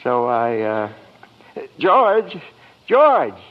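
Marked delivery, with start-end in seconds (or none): 1.8-3.4 shouted after him, high and loud; calling out. Acted. Angel shouting after George to come back. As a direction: yell after him as he runs off.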